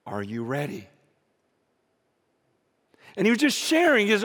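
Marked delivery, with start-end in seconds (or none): 0.1-0.9 very low and soft, a grave question; hushed solemn question. Retold or imitated. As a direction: drop right down to ask it quietly. Quoted funeral warning, first telling.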